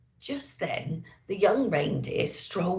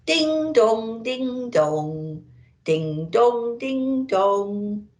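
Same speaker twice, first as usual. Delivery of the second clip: louder, sing-song ding dong; chiming bells; 4 semitones higher, 3 dB louder, much slower than usual. A narrator's reading. Distant church bells chiming, sing-song.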